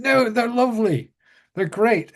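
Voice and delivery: high, gentle English insistence; sweet insistent lady. Retold or imitated. Caroline defending the Teletubbies, lightly voiced high sweet lady. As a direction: defend them with sweet conviction.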